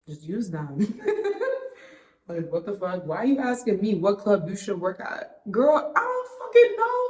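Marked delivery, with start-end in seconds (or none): 0.1-3.1 softer, giggling through the words; laughing. Real, not acted. Laughs at her own rant. 5.5-7.0 exasperated. Real, not acted. Exasperated at repeated DMs.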